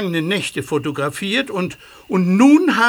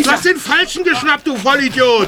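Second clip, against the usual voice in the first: angry; 7 semitones higher than usual. Acted. Officer furious at mistake.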